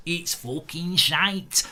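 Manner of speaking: slow, drawn-out mock Mancunian accent; hammed-up Manchester accent, stretching the words; Gary Neville impression. Streamer mocks Everton in a Manchester accent. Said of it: "I can't do the accent".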